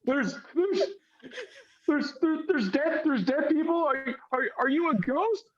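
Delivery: put-on high, frightened stammering girl; high-pitched child, alarmed and disbelieving; little girl voice. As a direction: stumble over the words, spooked little girl. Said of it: "kind of a little girl voice".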